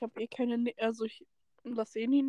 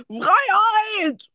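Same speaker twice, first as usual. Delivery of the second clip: shouting; 10 semitones higher, 10 dB louder than usual. Pretend. Mock cry for freedom.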